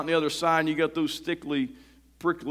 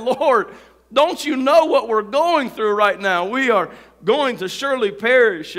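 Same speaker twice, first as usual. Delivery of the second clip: desperate plea; 6 semitones higher, 6 dB louder than usual. Retold or imitated. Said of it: "Sometimes we may feel like that".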